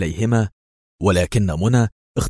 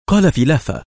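ordinary delivery, nothing marked

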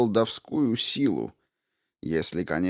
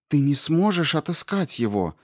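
pleading; 8 semitones higher than usual. A narrator's reading. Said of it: "взмолилась принцесса".

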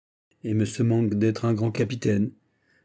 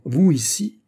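higher, louder amazed question; surprised exclamation; 6 semitones higher, 5 dB louder, slower than usual. A narrator's reading. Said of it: "s'écria d'Artagnan". (exclamation surprise, plus aiguë et plus forte)

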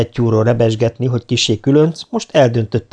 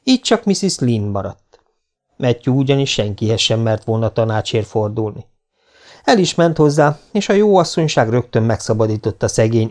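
ordinary delivery, nothing marked

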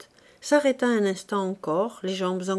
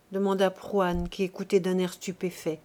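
ordinary delivery, nothing marked

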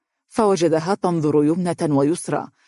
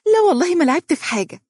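put-on much higher, fast, rushed girlish protest; blurted denial; 13 semitones higher, 3 dB louder, faster than usual. Mahra hastily denies touching Faris's work, high rushed protest. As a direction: blurt it out in one breath, defensive. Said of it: "قالت باندفاع".

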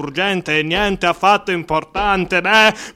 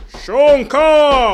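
calling out; 7 semitones higher, 5 dB louder than usual. Acted. Calling out to the madman.

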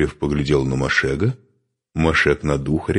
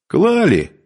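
high, loud "Клали" called out; cheerful; 6 dB louder than usual. A narrator's reading. Newcomer cheerfully butting in from the yard, cheerful. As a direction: chime in with a bright, raised call. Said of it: "раздался весёлый голос".

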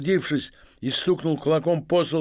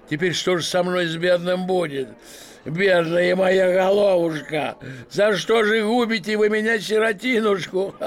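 mock lament; 6 semitones higher, 5 dB louder than usual. Masked man mocking the officials with a fake lament. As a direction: ham up the poor victim.

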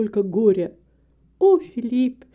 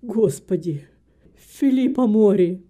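put-on stunned old man crying out, louder on the name; astonished exclamation; 5 dB louder than usual. A narrator's reading. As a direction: build from invocation to loud recognition. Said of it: "воскликнул". (потрясённое восклицание старика)

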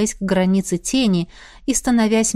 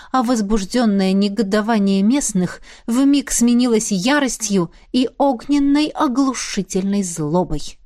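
ordinary delivery, nothing marked

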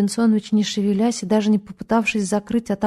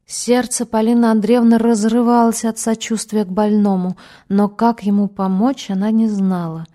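plain reading voice

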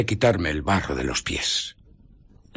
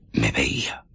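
whisper; 8 semitones lower than usual. Acted. Invisible man whispers being seen.

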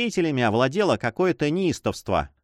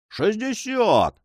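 shouted bid; 9 semitones higher, much slower than usual. A narrator's reading. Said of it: "закричал один авантюрист".

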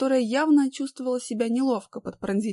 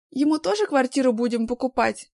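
насмешливо; 4 semitones higher, 6 dB louder than usual. A narrator's reading. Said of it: "насмешливого вопроса".

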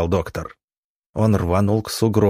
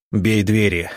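ordinary delivery

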